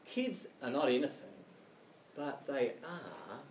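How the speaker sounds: usual speaking voice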